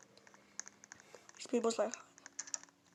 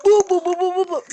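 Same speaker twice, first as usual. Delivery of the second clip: taunting boo; 5 semitones higher, 5 dB louder, faster than usual. Pretend. Taunting the pig.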